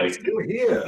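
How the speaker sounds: high mock sinister voice; a hint of a villain; evil voice. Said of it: "That's my evil voice".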